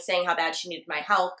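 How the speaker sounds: ordinary talk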